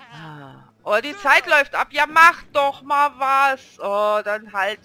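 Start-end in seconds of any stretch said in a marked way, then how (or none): 0.8-3.8 louder, higher plea to hurry; impatient urging. Real, not acted. Player to game figures as time runs out, impatient urging. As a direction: push them on against the clock.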